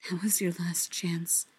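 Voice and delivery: hushed, soft inner voice; whispered. A narrator's reading. Fili's heart warns him of a lost chance, whispering. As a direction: let it slip out as a quiet whisper. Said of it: "something in Fili's heart that whispers".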